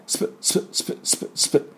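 スプ repeated unvoiced, just air; whispered sp drill. Shown as a demonstration. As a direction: breathe out スプ, スプ with no voice. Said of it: "この発音ね". (息だけでスプ、スプ)

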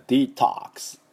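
American "デトックス" as dee-TAHKS; American English accent. Shown as a demonstration. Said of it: "アメリカ人の音でいきたい".

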